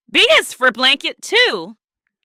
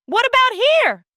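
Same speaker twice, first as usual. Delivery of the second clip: playful searching; 4 semitones higher than usual. Acted.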